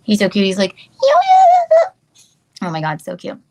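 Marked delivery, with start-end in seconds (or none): 0.8-1.8 squeaky very high loud puppy voice; dog voice. Pretend. Voicing the dog's greeting, very high and loud. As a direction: voice the dog as a tiny hype-man.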